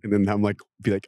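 ordinary delivery

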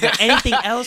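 loud, high, snippy question; attitude. Retold or imitated. Drive-thru lady with attitude, loud and high. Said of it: "She had an attitude".

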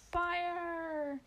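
loud, high final order; commanding shout. A narrator's reading. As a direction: yell the last command with force. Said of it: "Monty quickly commanded".

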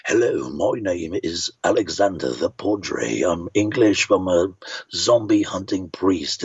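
deep movie-trailer voice with a hoity-toity English accent; slow, low, grand English priest; Alexander the Padre. Shown as a demonstration. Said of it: "what if I kind of combined".

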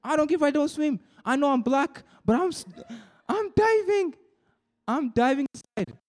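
excited declaration. Real, not acted. Preacher telling the youth group he dives in, excited and high. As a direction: throw yourself in with fervent excitement.

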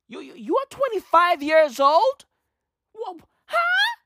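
high, incredulous "huh?" as if you can't believe it; shocked disbelief. Retold or imitated. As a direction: voice jumps way up in stunned disbelief. Crowd shocked at her age.

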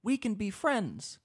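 high, pleading inner voice, slowing at the end. Retold or imitated. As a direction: squeak it out like a nervous puppy. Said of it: "I'm like".

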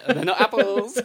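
loud, high, laughing protest; cracking up as you object. Real, not acted. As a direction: blurt it out through laughter. Laughing at his friend's crude joke.

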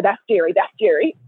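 ordinary delivery

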